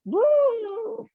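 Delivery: high hooting elephant sound; elephant call. Pretend. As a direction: hoot like an elephant. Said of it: "make our animal noise".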